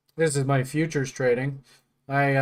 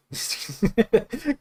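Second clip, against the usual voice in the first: laugh; 7 semitones higher than usual. Real, not acted. Laughing at the story.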